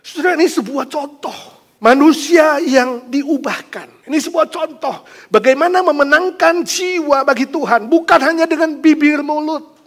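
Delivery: loud fervent exhortation; fervent preaching. Acted. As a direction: preach it with conviction. Preaching application.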